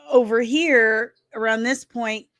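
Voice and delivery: usual speaking voice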